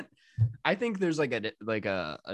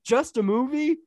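incredulous, high rising; 12 semitones higher, 5 dB louder than usual. Retold or imitated. Repeats quote higher.

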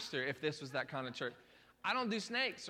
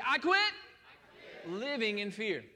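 slow, raised-voice declaration; congregational declaration; 3 semitones higher, 4 dB louder, slower than usual. Shown as a demonstration. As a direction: call it out for the congregation to repeat. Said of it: "say that with me this morning".

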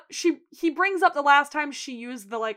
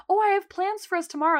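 eager Sheila; 8 semitones higher, 3 dB louder, faster than usual. Retold or imitated.

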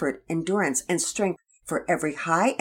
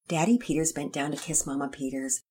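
ordinary delivery, nothing marked

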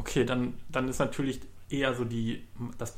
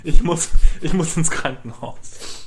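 mock panic; 5 semitones higher, 9 dB louder than usual. Mock panic about hospital.

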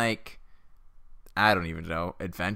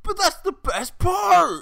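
much higher, slow mock protest; indignant author; slower than usual. Author protesting cutting the aliens, high indignant whine. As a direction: whine it like a wounded writer.

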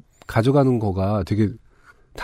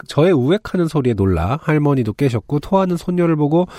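usual speaking voice

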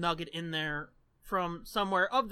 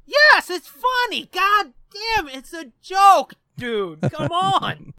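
loud, high frustrated yelling; exasperated shout; 10 semitones higher, 13 dB louder, slower than usual. Real, not acted. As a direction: yell it out in frustration. Exasperated over joke not landing.

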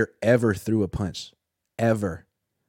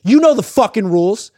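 loud high ranting coach; alpha coach yelling; 9 semitones higher, 8 dB louder than usual. Retold or imitated. Reporter voicing Harbaugh ranting at a player, shouting. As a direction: rant like the alpha male of the field.

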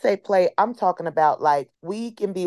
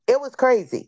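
"It was crazy" said much higher, with wonder; high amazed; 7 semitones higher than usual. Real, not acted.

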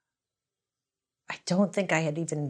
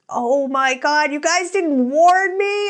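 high, loud, slow, put-on squeal of disbelief; mock outrage; 10 semitones higher, 6 dB louder, slower than usual. Cashier mock-wails at coworkers who didn't warn her. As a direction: wail it at your friends in disbelief. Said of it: "And I was like".

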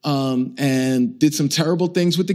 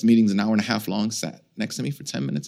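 normal talking voice

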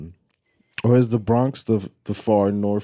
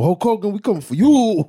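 hyped-up callout at Hogan, higher; wrestling promo; 9 semitones higher, 3 dB louder than usual. Pretend. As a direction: bark it like a heel on the mic. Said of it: "I'm cutting a promo".

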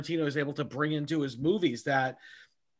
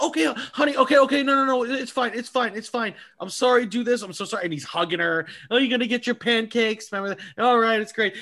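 higher, pleading reassurance; calm her down at all costs; mock soothing husband; 7 semitones higher, faster than usual. Man soothing his upset girlfriend so she stops crying, higher and pleading.

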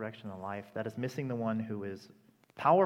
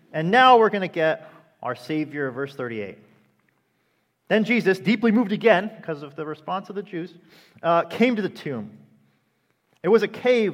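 much louder and higher, driving the sermon; full-force preaching; 5 semitones higher, 13 dB louder than usual. Acted. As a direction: preach it out to the whole room.

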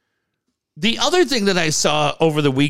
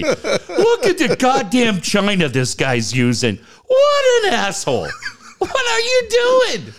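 high laughing rant at fans; laughing outrage; 12 semitones higher than usual. Real, not acted. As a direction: yell at the fans while cracking up.

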